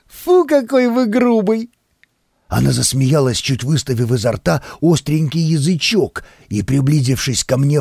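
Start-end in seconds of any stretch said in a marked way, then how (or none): 0.1-1.7 put-on coy girl voice. A narrator's reading.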